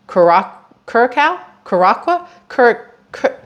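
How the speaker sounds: broken, guessing attempts at "Curacao", stopping mid-word; unsure pronunciation. Real, not acted. Reader stuck on a place name, unsure pronunciation. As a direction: sound out "Curacao" haltingly, with questioning rises. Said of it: "I don't know how to say this word".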